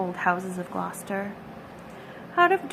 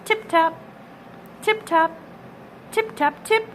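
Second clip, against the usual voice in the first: tapping noises; 6 semitones higher than usual. A narrator's reading. Mice tapping sounds again.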